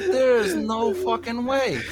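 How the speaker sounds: high, slow, drawn-out disbelief; mock-devastated, slow and high; stunned disbelief. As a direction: stretch it out high, aghast. Retold stunned disbelief, tear falling.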